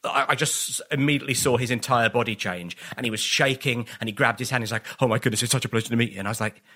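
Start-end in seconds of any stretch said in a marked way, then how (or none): 5.0-6.2 fast gush. Retold or imitated. Starstruck actor gushing, retold.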